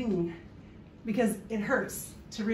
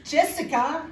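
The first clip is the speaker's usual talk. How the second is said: high, loud mock German-accented name; botched Catalan accent, German-sounding; 6 semitones higher, 5 dB louder than usual. Shown as a demonstration. Comedian attempting mother-in-law's Catalan accent, comes out German. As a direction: try Catalan and land in German. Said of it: "that's German".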